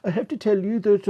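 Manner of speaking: high, loud Scandinavian lilt; Scandinavian accent. Retold or imitated. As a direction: chair announcing in Scandinavian accent. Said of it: "generic Scandinavian voice now".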